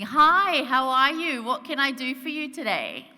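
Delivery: overly cheerful, loud Aussie hello; chirpy and in their face; bubbly Australian greeting. Retold or imitated. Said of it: "I'm being very Australian".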